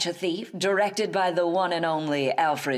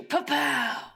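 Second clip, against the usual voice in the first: pa-pow; 9 semitones higher than usual. Retold or imitated. Sound effect repeated.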